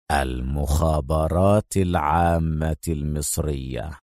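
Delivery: low, soft, slow reveal; low confiding villain. Acted. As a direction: lean in and drop the bombshell. Ivanovich leans in, reveals. (همس الشرير البطيء)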